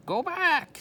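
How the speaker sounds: high, loud parrot squawk. A narrator's reading. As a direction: screech it like a bird. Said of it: "squawked Polly".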